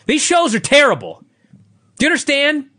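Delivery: blowing his lid over these shows; angry rant. Real, not acted. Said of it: "Oh, too late".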